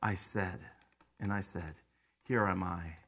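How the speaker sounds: low, soft, reverent reading; hushed close. Real, not acted. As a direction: let it fall to near silence.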